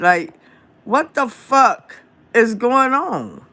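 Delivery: real exasperation, voice jumping high; exasperated outburst. Real, not acted. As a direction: let the frustration climb up into a high, incredulous rant. Exasperated rant about politicians.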